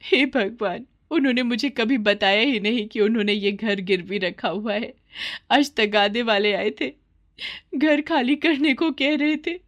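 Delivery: a distressed widow pouring out bad news; mother's voice.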